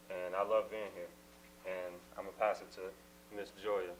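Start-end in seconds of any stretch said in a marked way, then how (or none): none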